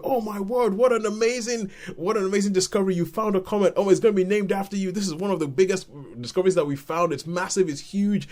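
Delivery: high, breathless rush of congratulation; gushing excitement. Acted. Everybody congratulating the student on her comet, gushing excitement. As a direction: pile praise on in a high, eager voice. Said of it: "everybody's like".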